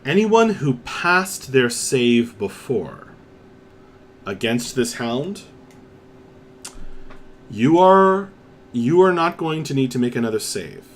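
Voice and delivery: ordinary talk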